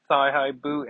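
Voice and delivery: ordinary delivery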